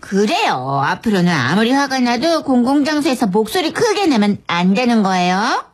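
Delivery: put-on cooing praise for a little child; kindergarten-teacher talk. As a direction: gently lecture him like a toddler. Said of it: "돌아오는 건 애기 취급이었거든요".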